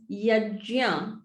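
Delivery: "yajya" slowly, with j in the middle; "yajya" drawn out, no hard g; correct pronunciation. Shown as a demonstration. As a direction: confirm "yajya" as yaj-ya, carefully. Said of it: "to say it like this".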